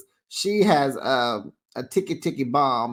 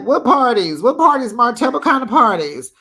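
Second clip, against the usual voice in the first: loud, high outraged demand; incredulous shouting; 8 semitones higher, 8 dB louder than usual. Retold or imitated. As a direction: shout it in disbelief. Host's outraged retort to Martell.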